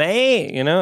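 ordinary talk

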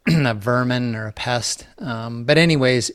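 ordinary talk

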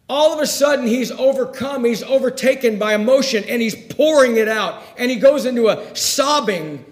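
high, loud, driving sermon voice; full-force preaching. Acted. Preacher telling of Jesus overcome at the grave, full force. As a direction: pour it out at full pulpit force.

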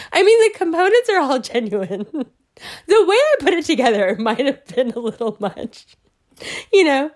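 high, squeezed through laughter; giggly, sheepish backpedalling; laughing admission. Retold or imitated. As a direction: confess it while cracking up.

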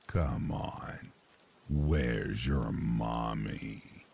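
low, very slow coaxing creep; sweet creepy coaxing. Retold or imitated. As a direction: drawl it out, low and sinister. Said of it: "a very low and creepy man's voice".

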